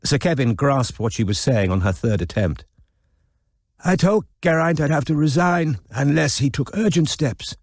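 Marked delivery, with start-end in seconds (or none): 3.8-7.5 put-on a touch of misery in a frail old voice; mildly wretched old man; miserable. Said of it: "he said miserably".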